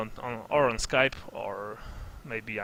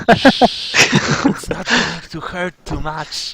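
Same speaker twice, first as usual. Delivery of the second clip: slow, creepy hushed murmur; creepy pervert voice; 3 semitones higher, 8 dB louder, much slower than usual. Retold or imitated. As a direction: creep them out, slow and sinister. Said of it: "pervy man impression".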